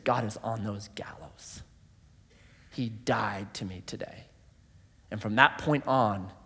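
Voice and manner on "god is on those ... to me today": hushed, soft and low; inner whisper. Retold or imitated. Elie speaking in his heart at the gallows, hushed whisper. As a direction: barely breathe the words like a private thought. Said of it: "Elie said in his heart".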